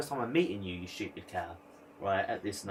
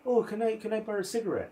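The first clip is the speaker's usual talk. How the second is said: sweetly asking traveller woman; 10 semitones higher, faster than usual. Retold or imitated. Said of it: "she goes".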